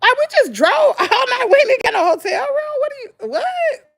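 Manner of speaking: high-pitched exasperated disbelief; retelling her outburst at the front desk; incredulous. Retold or imitated. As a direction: tell the clerk off in disbelief. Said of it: "I was like".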